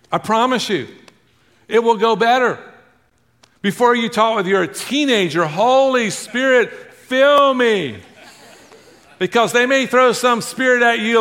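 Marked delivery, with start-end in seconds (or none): 5.5-8.0 high, loud cry for help; hammed-up prayer, pitched high; mock urgent plea. Parent praying before talking with a teenager, mock urgent plea.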